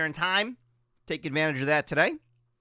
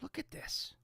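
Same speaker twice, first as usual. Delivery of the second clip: whisper; 8 semitones higher, 16 dB quieter than usual. Real, not acted. Showing old studio photo.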